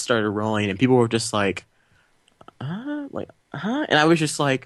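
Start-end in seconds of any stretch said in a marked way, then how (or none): 2.6-3.9 small, high-pitched puzzled "huh"; baffled audience. Retold or imitated. Moviegoer reacting as the credits roll, baffled. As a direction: imitate bewildered moviegoers, soft and high.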